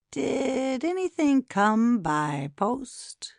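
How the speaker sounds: slow, hushed probing whisper. Acted. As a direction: whisper the question slowly, prying. Villain leaning in, whispering.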